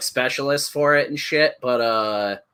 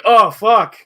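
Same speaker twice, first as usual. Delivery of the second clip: oh fuck; 9 semitones higher, 7 dB louder than usual. Retold or imitated. Realising he trapped himself.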